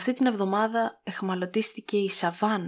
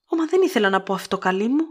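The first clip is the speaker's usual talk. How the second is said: louder, quick flustered outburst; flustered cry; 4 semitones higher, 7 dB louder, faster than usual. A narrator's reading. Melanie blurting to Scarlett, flustered and loud. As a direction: blurt it out, flushed with embarrassment. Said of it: "κράβγασε η Μέλανη κοκκινίζοντας από ταραχή".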